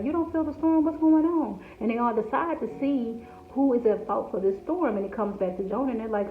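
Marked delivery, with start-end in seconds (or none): 0.0-1.6 high, loud alarmed sailor voice; panicked sailors. Acted. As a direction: shout up at him in alarm. Panicked sailors wake Jonah.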